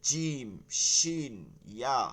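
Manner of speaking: জিম, শিন, ইয়া held firm and slow; hard articulation. Shown as a demonstration. Teacher showing students the hard letters, firm slow articulation of each. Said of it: "শক্ত পড়তেছি".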